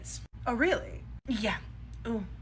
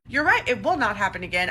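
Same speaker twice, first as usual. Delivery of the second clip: ordinary talk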